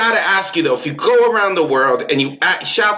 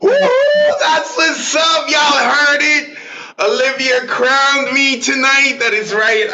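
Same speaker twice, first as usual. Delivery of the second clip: real whoop, much higher and drawn out slowly; excited shout; 8 semitones higher, slower than usual. Real, not acted. Host crowned king of July fourth by Olivia, whooping with joy.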